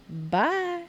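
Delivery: usual speaking voice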